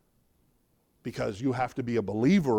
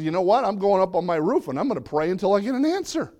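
higher, louder, fast resolute vow; determined Habakkuk; 6 semitones higher, 5 dB louder, faster than usual. Acted. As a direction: vow to pray until answered. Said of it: "He says".

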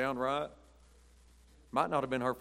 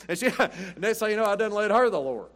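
laughing through the punchline, louder and higher; 8 semitones higher, 8 dB louder than usual. Real, not acted. Preacher laughing at his own story to the congregation. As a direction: chuckle it out, pleased with yourself.

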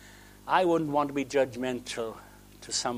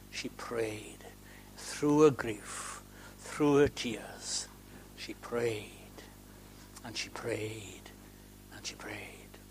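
softer and softer, fading to a breath; fading prayer; 5 semitones lower, 12 dB quieter, much slower than usual. Acted. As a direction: let each she prayed drop away. Fading intense repetition.

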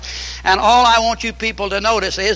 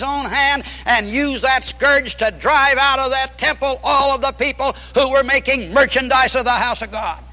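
higher-pitched, ringing sermon delivery; impassioned preaching; 5 semitones higher than usual. Acted. Preacher urging the congregation on God's house of prayer, impassioned. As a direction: preach it out, stretching the key words.

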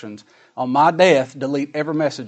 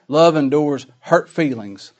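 normal talking voice

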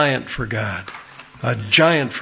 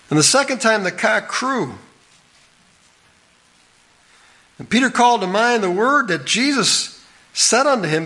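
raised, measured Bible reading; solemn King James reading, higher; scripture reading; 7 semitones higher than usual. Acted. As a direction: read the verse out to the congregation, slowing on the quote. Preacher reads the scripture aloud.

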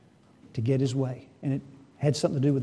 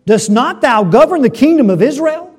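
loud, high rhetorical demand; acted queenly rebuke; indignant challenge; 7 semitones higher, 13 dB louder than usual. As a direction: challenge the king at full volume. Jezebel's challenge to Ahab, acted.

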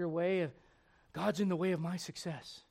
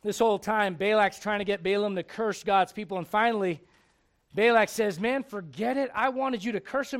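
much louder, fervent storytelling; preaching at full force; 5 semitones higher, 10 dB louder than usual. Acted. Preacher acting Balak and Balaam, full-force preaching.

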